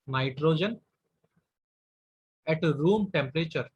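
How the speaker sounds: dictating with long gaps between words; slow dictation. Shown as a demonstration. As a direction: give each word time to be written. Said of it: "dictating notes with long pauses". (रुक-रुककर लिखवाते हुए)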